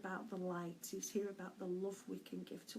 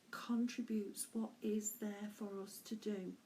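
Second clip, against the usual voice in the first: normal talking voice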